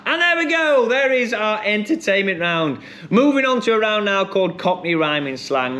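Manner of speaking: high game-show host call, gradually settling; quizmaster announcement. Acted. Host moving players on to the next round, showy quizmaster announcement. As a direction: launch high to close the round, then ease down.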